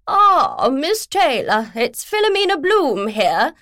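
booming, high-pitched posh lady; loud posh woman. Acted. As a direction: boom it down the phone like a grand lady. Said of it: "A loud, posh female voice boomed".

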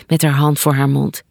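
ordinary delivery

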